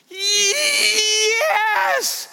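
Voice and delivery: very high, long squealed "yes"; imitated gleeful scream. Grandfather wishing her a life of glee, imitated joyful scream. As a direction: whoop it out like a thrilled child.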